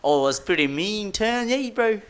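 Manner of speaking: put-on playful New Zealand surfer accent, pitch climbing high on bro; hamming up a New Zealand surf bro, lightly done; Kiwi bro accent. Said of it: "I was trying to do this accent yesterday".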